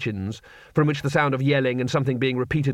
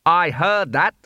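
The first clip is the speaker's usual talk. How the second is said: louder, higher call from overhead; voice from above; 5 semitones higher, 5 dB louder, slower than usual. A narrator's reading. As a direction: call down from the ceiling. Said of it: "said a voice from above".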